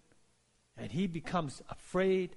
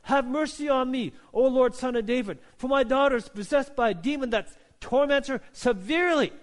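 loud, high plea for mercy; preacher voicing a desperate mother's cry; 8 semitones higher, 6 dB louder than usual. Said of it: "she cries out to the Lord".

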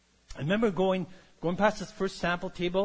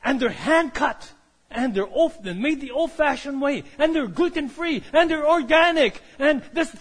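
louder and higher, piling on the selling points; excited sales pitch; 5 semitones higher, 6 dB louder than usual. Retold or imitated. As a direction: build the pitch, each claim bigger than the last. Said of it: "But she says".

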